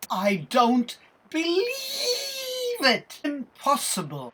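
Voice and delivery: theatrical disbelief. Acted. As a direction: exclaim it like an astonished show title. Announces the adventure's dramatic title.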